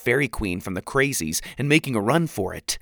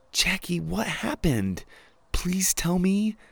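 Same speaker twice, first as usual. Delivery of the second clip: gentle pleading; 7 semitones higher, slower than usual. Acted. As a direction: coax it out tenderly. Gently pleading to hurt friend.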